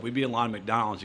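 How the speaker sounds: normal talking voice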